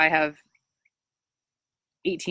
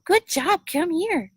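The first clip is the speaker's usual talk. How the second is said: high, bright-pitched praise to a puppy; praising puppy voice; 14 semitones higher, 3 dB louder, much slower than usual. Shown as a demonstration. Trainer calling her puppy over in high praise voice. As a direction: call the pup in with sky-high praise.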